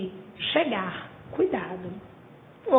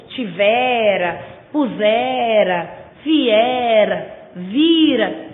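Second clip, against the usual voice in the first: stretched tivera, pusera, viera, vira with the final 'ra' falling away; slow, drawn-out words with a weak last 'ra'; unstressed final RA; 4 dB louder, much slower than usual. Shown as a demonstration. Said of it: "não é a sílaba tônica".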